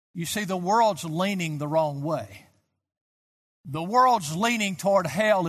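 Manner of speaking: usual speaking voice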